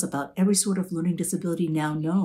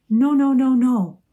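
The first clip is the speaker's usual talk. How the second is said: high, louder protesting no's; flustered refusal; 8 semitones higher, 9 dB louder than usual. A narrator's reading. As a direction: wave off the offer in a panic. Lulu refusing again.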